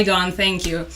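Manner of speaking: ordinary talk, nothing marked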